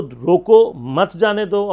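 loud, slow "روکو", break, then "مت جانے دو"; pause after روکو. Shown as a demonstration. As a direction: punch روکو by itself, keep مت with جانے دو. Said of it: "دو بالکل الگ الگ ہیں".

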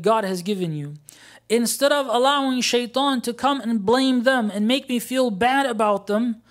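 high, loud exhortation; impassioned preaching. Acted. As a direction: urge the congregation with full force. Preaches at full force.

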